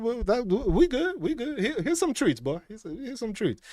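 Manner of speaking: high, placating voice, first words slow; nervous appeasing. Retold or imitated. Appeasing the cat.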